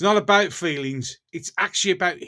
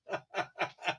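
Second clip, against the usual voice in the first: soft, voiceless chuckle; quiet laugh; 13 dB quieter than usual. Real, not acted. Asked about watches for sale, quiet amused laugh. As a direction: laugh softly under the breath.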